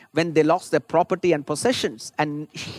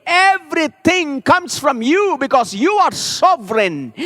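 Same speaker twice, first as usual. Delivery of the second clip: loud, higher, ringing sermon climax; full-force preaching; 7 semitones higher, 5 dB louder than usual. Acted. Preacher cries out Job's prayer to the Lord, loud and soaring. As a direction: lift the congregation with triumphant fire.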